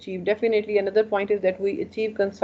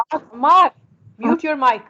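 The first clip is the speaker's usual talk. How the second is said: raised, higher call to a student; loud classroom reprimand; 6 semitones higher, 8 dB louder than usual. Real, not acted. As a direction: call out sharply over the class. Teacher calls out unmuted student.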